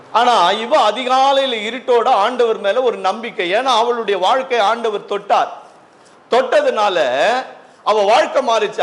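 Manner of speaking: high-pitched, emphatic sermon delivery; fervent preaching. Acted. Preacher telling church of her changed life, fervent. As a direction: preach it high, stretching key phrases.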